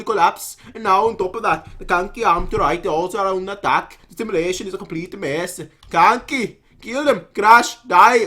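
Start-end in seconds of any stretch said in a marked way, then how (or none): 5.9-8.2 slow battle cry. Acted. Voicing Kanki's battle cry.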